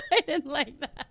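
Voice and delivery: can barely say it for laughing; laughing hard. Real, not acted. Cracking up at friend's line.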